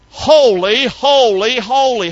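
each "Holy" hit loud and high; emphasis by repetition. Shown as a demonstration. Preacher showing biblical emphasis, every "holy" loud and high. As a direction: punch every "holy" with a rising swing. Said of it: "they use emphasis".

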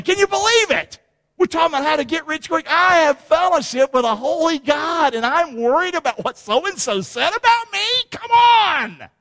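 high-pitched, fired-up preaching; incredulous exhortation. Real, not acted. Preacher scoffing at worry over what so-and-so said, incredulous. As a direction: challenge them in disbelief, building to a cry.

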